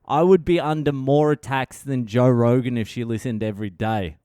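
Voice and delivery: ordinary talk, nothing marked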